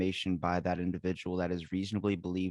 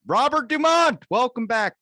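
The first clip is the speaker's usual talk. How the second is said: high loud host call out; excited greeting; 10 dB louder than usual. Acted. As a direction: announce the guest with a big welcome. Host greeting guest loudly.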